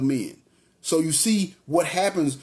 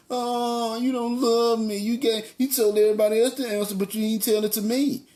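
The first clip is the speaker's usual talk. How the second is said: high, mock-tearful complaining whine; hammed-up pout to guilt him; mock whining wife; 6 semitones higher than usual. Wife whining at Samson for the answer, mock-tearful high whine. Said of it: "She threw them feminine".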